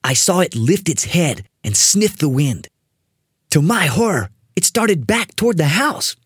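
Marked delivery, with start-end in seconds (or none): none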